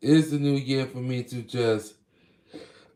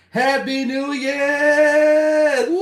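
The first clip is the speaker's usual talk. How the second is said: high, loud, drawn-out cheer; new year countdown crowd; 11 dB louder, much slower than usual. Real, not acted. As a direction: belt it out at midnight. Midnight celebration cheer.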